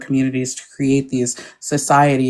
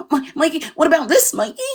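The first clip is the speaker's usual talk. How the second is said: mock fawning fan; 11 semitones higher than usual. Mocking fans fawning over Mikey.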